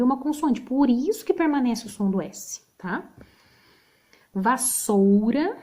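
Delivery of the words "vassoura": Vassoura said slowly, the double S hissed clearly; slow demo word. Shown as a demonstration. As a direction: model Vassoura for young pupils. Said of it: "é com dois S's". (Vassoura pronunciada devagar)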